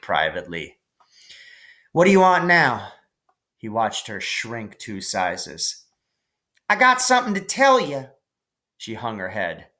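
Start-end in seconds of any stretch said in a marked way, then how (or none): 1.9-2.9 annoyed snap. Acted. Annoyed brother snaps at sister. 6.7-8.1 put-on high, eager little girl calling; little sister voice. Little sister Maybell calling to her brother, high and eager.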